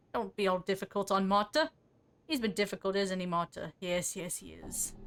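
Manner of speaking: ordinary talk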